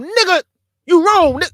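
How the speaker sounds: high, loud angry yell; yelling at a brother in anger. Shown as a demonstration. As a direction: scream it in his face. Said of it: "you're yelling at the man".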